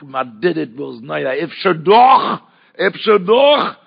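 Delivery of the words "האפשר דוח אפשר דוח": slow, high, loud Yiddish-tinged plea; rabbi's drawn-out cry. Retold or imitated. Rabbi shouting to the boy, slow and high. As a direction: stretch each word like a wailing call. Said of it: "הוא צועק".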